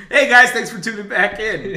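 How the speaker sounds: loud, high showman welcome; game show host. Acted. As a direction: belt it out like a TV host. Host voice relaunch intro.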